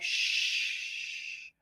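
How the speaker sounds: soft whispered shush; hush. Retold or imitated. Calm brother-in-law hushing the panic, whispered. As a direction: hush everyone gently. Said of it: "let's be peaceful".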